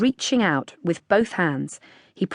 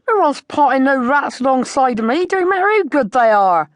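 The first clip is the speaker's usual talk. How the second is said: much higher and louder indignant farmer; angry; 8 semitones higher, 7 dB louder than usual. A narrator's reading. Farmer refusing any shooting beside him, loud angry voice. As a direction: shout it down, not having it.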